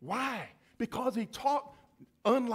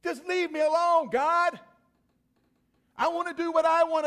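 higher, louder petulant plea to God; a rebellious person telling God to go away; defiant sinner; 8 semitones higher, 7 dB louder than usual. Retold or imitated. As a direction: whine it like a stubborn sinner pushing God away. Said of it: "Have you ever said that to God".

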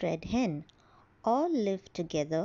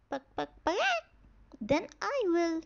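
clucking hen; 7 semitones higher than usual. Acted.

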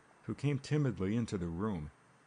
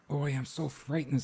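a touch of fright, pitched up; understated fear, higher voice; frightened; 6 semitones higher than usual. A narrator's reading. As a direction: say it with a mild shudder. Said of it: "said the girl with a shudder".

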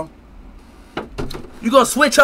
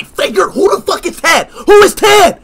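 raised, disbelieving demand; exasperated shout; 4 semitones higher than usual. Acted. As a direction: shout it at a friend denying who he is. Quarrel over the friend's name.

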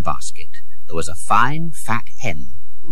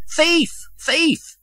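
high-pitched cry without much volume; contained, high mock shout; shouted accusation; 3 dB quieter than usual. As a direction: cry out "thief" to the street. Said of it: "He shouted".

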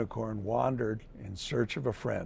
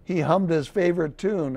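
usual speaking voice

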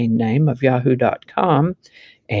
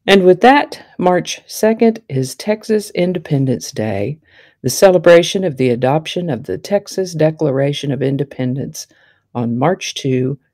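ordinary talk

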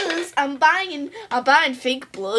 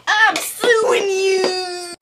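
slow furious shout; 5 semitones higher, 5 dB louder, much slower than usual. Acted. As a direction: drag it out, shouting through tears. Acted furious vow.

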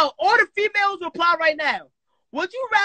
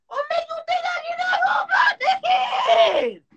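very high, sustained mock wail; outraged screech. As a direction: screech the question in exaggerated disbelief. Mock outrage at friend's doubt.

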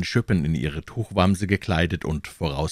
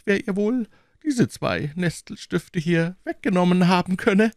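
sly cardinal; 13 semitones higher than usual. Acted. Cardinal insinuates to the king.

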